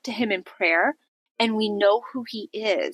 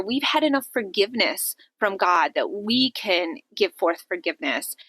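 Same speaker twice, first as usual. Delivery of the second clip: normal talking voice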